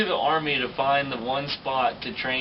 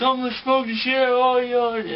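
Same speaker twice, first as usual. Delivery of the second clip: much higher, louder furious sergeant voice; angry threat; 12 semitones higher, 6 dB louder than usual. Retold or imitated. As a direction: threaten them like a sergeant losing it. Drill sergeant threatens the recruits.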